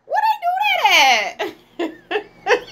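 high, slow laugh-cry through the words; laughing so hard it nearly breaks; laughing disbelief. Real, not acted. Asking her viewers in disbelief, laughing.

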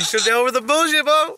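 high, loud retort; indignant full-volume squawk; shouted comeback. Real, not acted. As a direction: yell it back at them, pitch climbing. Shouts back at played clip.

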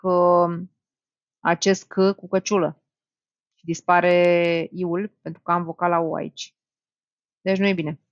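ordinary delivery

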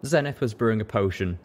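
ordinary delivery

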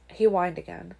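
usual speaking voice

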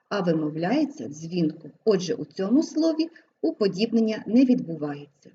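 ordinary talk

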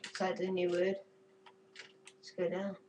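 ordinary talk, nothing marked

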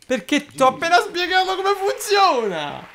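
high, exasperated complaint. Real, not acted. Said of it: "Perché ti arrabbi se perdo".

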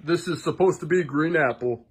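ordinary talk

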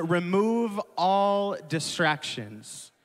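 slow emphatic point. Shown as a demonstration. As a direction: drive the point home slowly. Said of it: "I have three points".

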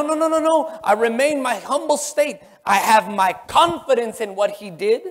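ordinary talk, nothing marked

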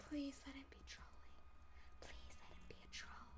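soft breathy prayer; whispered plea. Acted. Hoping the threat is a troll, whispered plea. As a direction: murmur it like a secret wish.

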